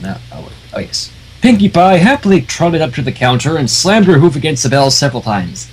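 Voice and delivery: usual speaking voice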